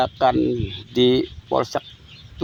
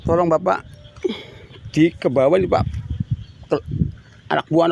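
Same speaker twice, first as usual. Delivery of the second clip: ordinary talk, nothing marked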